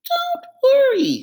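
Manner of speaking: high, quick, pleased goat voice; proud of the plan; little goat sure of himself; clever little goat. Acted. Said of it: "feeling very smart indeed".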